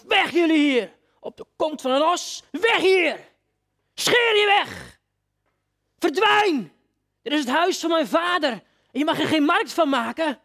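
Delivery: high, loud, slow enraged commands; Jesus driving the traders from his father's house; angry shout. Acted. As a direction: crack each order out like the whip.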